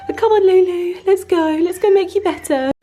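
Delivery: high, loud bright encouragement; rally her along brightly; cheery urging. Real, not acted. Owner cheerfully urging her cat to head out, high and loud.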